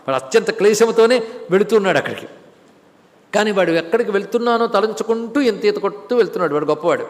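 usual speaking voice

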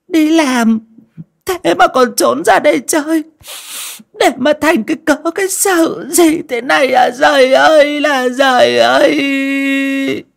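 high sobbing lament swelling to long cries; choked-up wailing. A narrator's reading. Aunt making a show of grief by the body, choked wailing. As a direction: put on crocodile tears, high and wailing. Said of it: "ngẩng mặt lên mà nghẹn ngào".

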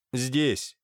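ordinary delivery

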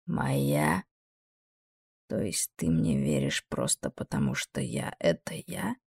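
very low, quiet demon voice; low wondering demon voice; deep demon voice. Acted.

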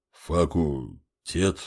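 very slow, low and soft mumble; hesitant murmur, syllables stretched apart; puzzled mutter. A narrator's reading. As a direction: sound out the unfamiliar word. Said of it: "пробормотал здоровяк".

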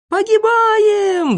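very high, drawn-out scream of doom; panicked wail. A narrator's reading. Boy screams as balloon plummets, wailing in panic. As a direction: wail it long and loud, in panic. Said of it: "завопил Незнайка".

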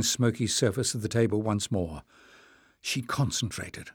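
ordinary delivery

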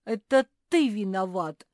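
high, loud accusing cry; sudden shout. A narrator's reading. Bear cub accusing his friend, sudden shout. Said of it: "неожиданно закричал Фил".

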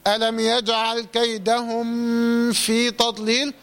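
meem of كيدهم held in a long hum before في; slow recitation, lingering nasally on the م of كيدهم; mistaken nasalised meem. Shown as a demonstration. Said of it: "وما تغنش".

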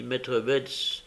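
usual speaking voice

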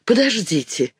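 sudden cry. A narrator's reading. Marina remembers her bag as they leave, sudden high cry. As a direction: call out in sudden alarm. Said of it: "крикнула вдруг Марина".

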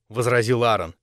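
ordinary delivery

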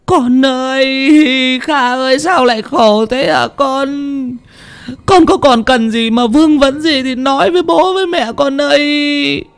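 very high, drawn-out parental wail; wailing in grief. A narrator's reading. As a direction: cry out to the dead son, stretching the calls. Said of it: "đứng ở ngoài mà gào khóc". (gào khóc thảm thiết, giọng rất cao, kéo dài)

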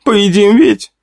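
put-on high little boy's voice; eager hungry child asking mom; young boy. A narrator's reading. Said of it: "спросил мальчик лет восьми".

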